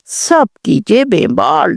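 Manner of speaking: ordinary delivery, nothing marked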